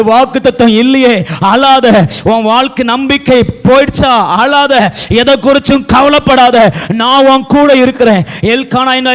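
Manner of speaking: mounting prophetic reassurance from the pulpit; preaching, building. Acted. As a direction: build phrase on phrase without letting up. Sermon builds to full force.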